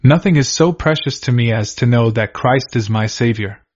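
ordinary delivery

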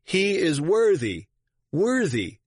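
high-pitched cry of approval; narrator's lifted, proclaiming shout; narrated acclamation. A narrator's reading. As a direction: call it out high, with drawn-out emphasis. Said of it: "shouted out".

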